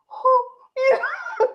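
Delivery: laughing high and loud in embarrassment; laughing cringe. Real, not acted. Speaker cringing at the meeting, laughing loudly. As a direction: squirm with laughter at the awkward moment. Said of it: "to the point where you're like".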